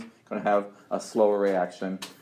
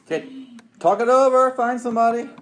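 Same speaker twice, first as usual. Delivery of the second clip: loud, high call over the room chatter; teacher calling over a noisy classroom; calling out to class; 12 semitones higher, 8 dB louder than usual. Real, not acted. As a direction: raise your voice to get the class talking.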